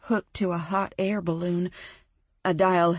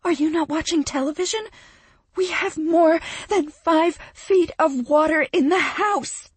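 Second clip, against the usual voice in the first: high, loud and fast, yelling down the phone; shouting; 9 semitones higher, 7 dB louder than usual. Acted. Friend shouting down the phone about floodwater, high and loud. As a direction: shout it over the flood noise, panicked. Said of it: "she shouts into the phone".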